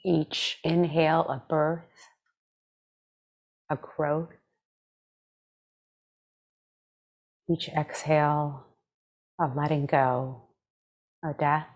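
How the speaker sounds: calm, measured meditation voice with long pauses; guided meditation. Acted. Meditation teacher leading listeners through breath and body scan, calm guided meditation. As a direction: guide them gently, leaving space after each phrase.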